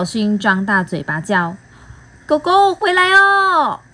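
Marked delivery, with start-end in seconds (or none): 2.3-3.8 high, slow call after the dog; long high call to a runaway dog. Acted. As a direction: shout out for the dog without thinking. Mouth calls the dog.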